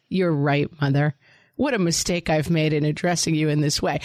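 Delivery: low, formal, over-proper apology; hammed-up dutiful child repenting to mother; mock-contrite child. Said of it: "it's not like they're like".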